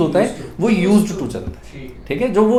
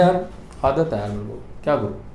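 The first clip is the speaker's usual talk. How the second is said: resigned mumble; 8 semitones lower, 9 dB quieter, faster than usual. Retold or imitated. Resigned husband quoted.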